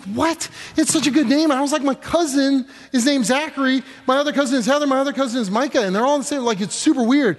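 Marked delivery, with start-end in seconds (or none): 0.0-1.5 excited disbelief. Retold or imitated. Wife's excited reaction in retold story. 1.8-7.4 excited protest. Retold or imitated. Retold arguing with wife.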